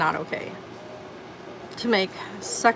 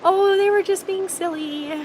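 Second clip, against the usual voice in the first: mocking excuse; 12 semitones higher, 3 dB louder than usual. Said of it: "people out there who think".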